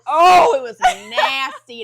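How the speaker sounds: loud, drawn-out disgust; slow, emphatic revulsion; disgusted outburst. Real, not acted. Recalling the spit with disgust.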